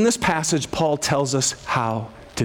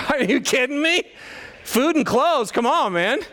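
mock disbelief; 8 semitones higher than usual. Mocking listener's disbelief.

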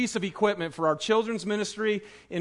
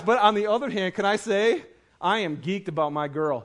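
ordinary delivery

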